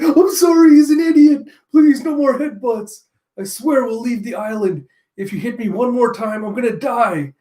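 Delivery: high, loud panicked begging; desperate pleading. Acted. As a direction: plead frantically to stop the beating. Nami begs villain to stop.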